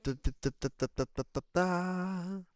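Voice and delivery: rapid-fire da-da-da ending on a high held note; sung-out da-da-da after a fluffed line; nonsense da-da-da. Real, not acted. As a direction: stutter out da-da-da, then hold the last one high. Vocal reset after fumbled line.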